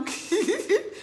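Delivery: high-pitched giggle; petit rire aigu. Real, not acted. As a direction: let out a high, teasing giggle. Rit en taquinant Francine. (petit rire aigu et taquin)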